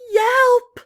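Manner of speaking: high loud puppy yelp. Acted. Lost pup calling for his pack, high loud yelp. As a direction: let out a desperate little yelp. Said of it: "longed to howl".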